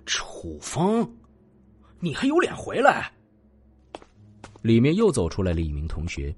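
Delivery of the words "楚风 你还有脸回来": hostile. A narrator's reading. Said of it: "登时脸色大变".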